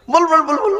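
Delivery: high, eager urging. Acted. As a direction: coax the crowd to shout. Urging audience to answer.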